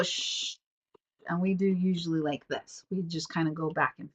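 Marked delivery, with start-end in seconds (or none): 0.0-0.6 a soft unvoiced "Shh"; shushing. Shown as a demonstration. Showing the usual way parents hush a baby, whispered. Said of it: "so we naturally go".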